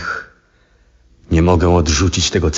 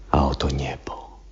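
whispered in awe; an awed whisper over the night sky; hushed whisper; 3 semitones lower, 8 dB quieter than usual. Acted. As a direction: breathe it out, almost soundless. Reverent showing of the sky.

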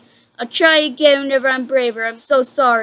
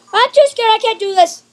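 high, louder panicked cry; scared outburst; 9 semitones higher, 4 dB louder than usual. Real, not acted. Player gives up on the horror game, scared outburst. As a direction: burst out in high-pitched fear.

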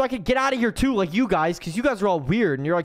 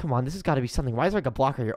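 usual speaking voice

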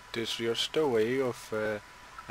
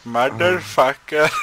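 mock Indian accent; 8 dB louder than usual. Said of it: "I did one a little bit like".

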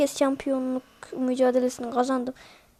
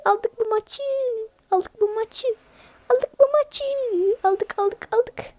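excited cheering; 9 semitones higher than usual. Real, not acted. Cheering a match win.

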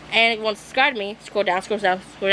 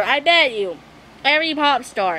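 ordinary delivery

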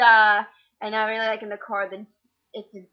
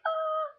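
high gasp; 4 dB quieter than usual. Retold or imitated. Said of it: "they were like".

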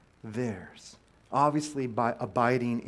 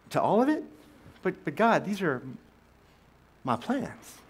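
whiny protest; 6 semitones higher than usual. Retold or imitated. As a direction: whine like a thwarted kid. Protesting God's refusal.

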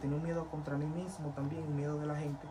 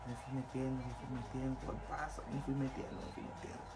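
each repeat softer until it is almost gone; fading repetition; 3 semitones lower, 10 dB quieter than usual. Acted. Telling how he slid into sin, voice dying away. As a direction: let the phrase sink away to nearly nothing.

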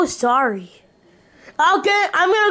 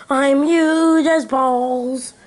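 ordinary talk, nothing marked

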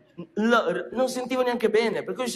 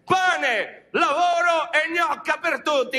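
high, slow rally slogan; slogan delivery; 8 semitones higher, slower than usual. Acted. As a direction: proclaim it like a political rallying cry. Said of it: "chiuderò con le parole magiche". (tono da comizio, lento e acuto)

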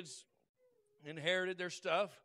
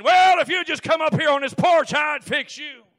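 loud, higher shout from the porch; shouted threat; 5 semitones higher, 19 dB louder than usual. Retold or imitated. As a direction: holler a threat back. Porch fight taunt acted out.

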